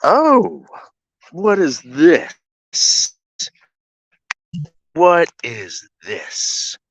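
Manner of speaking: slow, drawn-out wondering; slow amazed. Real, not acted. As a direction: stretch it out in awe.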